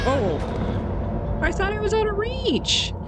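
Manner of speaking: high, shocked yelp of disbelief; surprised. Real, not acted. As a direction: caught off guard, voice jumps way up. Caught by enemy unexpectedly.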